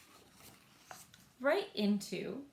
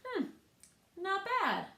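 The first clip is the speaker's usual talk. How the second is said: put-on approving girl; 10 semitones higher than usual. Girl judging her painted rock.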